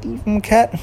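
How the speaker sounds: a lightly voiced girl; Girl gentle. A narrator's reading. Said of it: "And Girl said".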